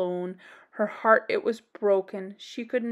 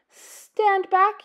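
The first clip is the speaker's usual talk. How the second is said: high, loud stand back; doctor's yell; 9 semitones higher, 6 dB louder than usual. Acted. Doctor shouting at the crowd, high and loud. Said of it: "yelled the doctor".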